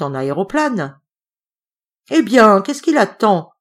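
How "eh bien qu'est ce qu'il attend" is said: impatient call. A narrator's reading. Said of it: "fait Hubert".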